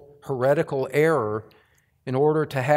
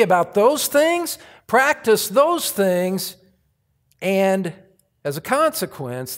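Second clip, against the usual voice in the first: preaching scripture; 5 semitones higher than usual. Acted. Paraphrases scripture, building intensity.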